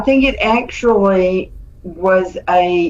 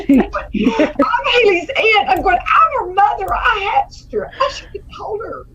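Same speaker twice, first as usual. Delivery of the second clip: mock squabble; 13 semitones higher than usual. Sisters fighting over baby.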